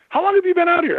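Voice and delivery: retold girl from the chapel, startled and high; alarmed question. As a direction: caught off guard, rushing the question.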